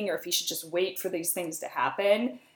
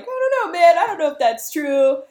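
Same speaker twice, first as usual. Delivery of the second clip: a hint of a puzzled warrior, pitched up; lightly voiced doubt, as if shrugging it off; unsure Macbeth and Banquo; 9 semitones higher, 7 dB louder, faster than usual. Macbeth and Banquo doubting the witches, mock puzzled high voice. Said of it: "Banquo and Macbeth are like".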